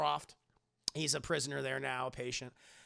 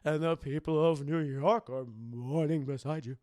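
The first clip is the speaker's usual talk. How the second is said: put-on slow, loud fussing old aunt; Aunt May voice; 8 dB louder, slower than usual. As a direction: put on a doting old aunt's voice. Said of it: "I gotta do the Aunt May voice".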